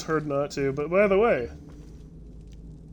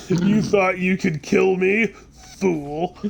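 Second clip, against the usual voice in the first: loud, slow, higher-pitched villain boast; taunting villain; 6 semitones higher, 7 dB louder, much slower than usual. Pretend. Guard scoffs at the failed knife throw, taunting villain. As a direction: play a triumphant guard mocking his attacker.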